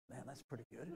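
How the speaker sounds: hushed, self-satisfied musing to himself; Peter. Acted. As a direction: think it softly, pleased with yourself. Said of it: "you can just imagine Peter thinking".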